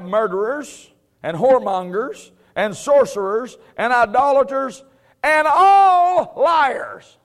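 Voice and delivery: slow, loud, stretched out; drawn-out preaching. Real, not acted. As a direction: hammer it home slowly.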